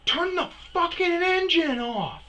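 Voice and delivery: high, loud, strained yell; furious shout. Retold or imitated. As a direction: scream the order at a careless driver. Furious order to the driver.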